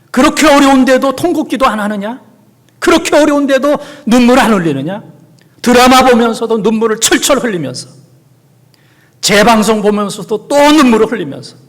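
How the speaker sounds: high, loud rebuke; fervent scolding. Acted. Preacher scolds believers who cry at dramas but not in prayer, loud. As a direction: press the congregation hard.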